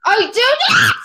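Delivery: high, loud protest through a laugh; indignant shout. Real, not acted. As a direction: shout back a laughing denial. Indignant denial when teased.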